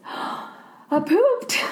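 put-on high squeaky little boy voice; kid's gasping confession. Teacher retelling the boy's gasp in class, squeaky kid voice. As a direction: blurt it like a shocked five-year-old. Boy announces accident, gasps.

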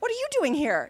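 high-pitched astonished question; startled surprise. Retold or imitated. Startled wife meets her husband.

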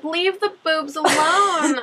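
high, slow whimpering plea; mock crying plea. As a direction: beg in a fake sob. Pleading for boobs, cry heard.

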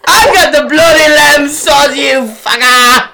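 furious chef yell. Retold or imitated. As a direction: bellow it like a raging head chef. Triumphant yell of a kitchen scene.

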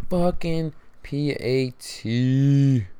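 very slow, stretched-out groan of the words; drawn-out disgust. Real, not acted. Fan repeating the missed-kick complaint, drawn-out disgust. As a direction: drag every letter out in disbelief.

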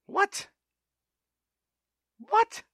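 squeaky, very high "What?"; incredulous. Acted. Ranting vlogger scoffs at the rules with a very high incredulous "What?". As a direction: leap way up in outraged disbelief.

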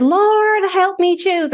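high, pleading cry to the Lord; lightly hammed-up plea to heaven; mock prayer. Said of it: "So I said".

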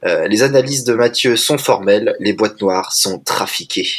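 ordinary talk

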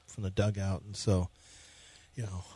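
low, soft, defeated mumble; mumbling dejected pitcher. Acted. Co-host playing the pitcher in a gloomy mumble. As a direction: answer like a beaten-down player. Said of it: "I'll be Ryan Presley".

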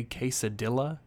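"quesadilla" said with an English "dilla", hard L; anglicized "quesadilla", L sounded instead of Y; mispronounced quesadilla. Retold or imitated. Family at Mexican restaurant, badly mispronounced "quesadilla". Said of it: "saying it like that".

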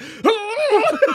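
high, loud unhinged cackle; wild rambling cackles. Pretend. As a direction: cackle like a deranged old man. Imagined future self laughing wildly.

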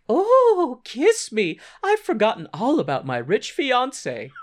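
high, melodramatic mock swoon; hammed-up sudden passion; swooning lover. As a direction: throw yourself at them in a girlish voice. Sibel swoons at Earl's wealth.

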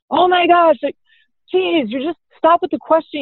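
high, fed-up "Oh my gosh"; exasperated teen. Retold or imitated. Teenager pushing back at parent's questions, exasperated. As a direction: sound like a teen sick of questions. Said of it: "if they say, like".

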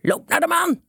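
high, angry shout. A narrator's reading. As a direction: yell it to send them away. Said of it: "schreeuwde de heer Van Daveren". (boos schreeuwend, met hoge stem)

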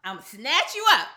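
mock-booming God line, teasing; voicing God. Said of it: "God said".

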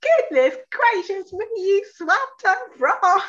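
very high, loud gushing; breathless excitement. Retold or imitated. Gushing at Louise about her swim, very high and excited. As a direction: gush like a starstruck fan. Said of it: "practically hyperventilating with excitement".

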